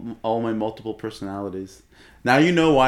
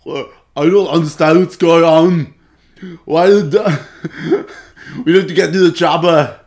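loud, slow action-hero impression; Arnold Schwarzenegger; 4 semitones higher, 10 dB louder, slower than usual. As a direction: bellow it in a mock Austrian accent. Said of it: "how to do Arnold Schwarzenegger".

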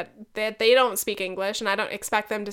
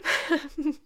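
higher laugh after a sorry; fake-sounding laugh; 6 semitones higher than usual. Real, not acted. Podcaster slips while apologizing to friend, fake-sounding laugh. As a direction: chuckle that sounds put-on. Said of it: "there I go again, fake laughing".

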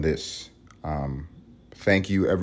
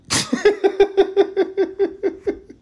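a sudden high burst of laughter; high squeal of laughter; 4 dB louder than usual. Real, not acted. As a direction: crack up at your own joke, way up high. Laughing at own joke.

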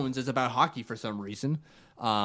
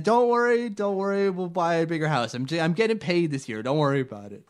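higher, louder mock husband; mock breezy husband; 5 semitones higher, 5 dB louder than usual. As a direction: brush off the wife's worry, hammed up. Husband reassuring wife acted out.

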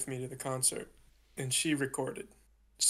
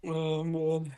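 after laughing hard; 2 semitones higher, 3 dB louder, slower than usual. Real, not acted. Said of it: "let me collect myself".

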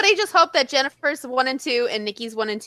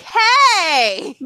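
excited hey; 9 semitones higher, 6 dB louder than usual. Retold or imitated. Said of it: "then it's like".

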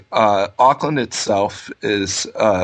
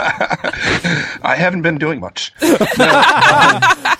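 laughing; 7 semitones higher, 3 dB louder, much slower than usual. Real, not acted. Laughing at being called lazy.

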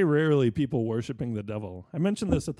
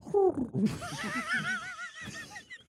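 high, soft pigeon coo; bird cooing; 8 dB quieter than usual. Acted. Playing the bird as he walks in, soft high coo. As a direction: coo gently like an amorous bird. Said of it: "And the bird goes".

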